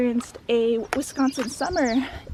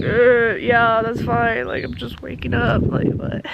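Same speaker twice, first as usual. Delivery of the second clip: bleary-eyed; slower than usual. Retold or imitated. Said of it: "I'm, like, bleary-eyed".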